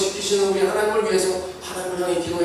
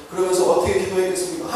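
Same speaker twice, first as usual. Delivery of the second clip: ordinary delivery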